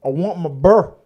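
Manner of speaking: imitating the girlfriend's Southern accent; mock Southern demand for her burr; Southern accent quote. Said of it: "very deep Southern accent".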